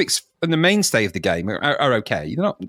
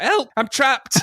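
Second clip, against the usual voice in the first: tiny trapped drummer; 11 semitones higher, slower than usual. Pretend.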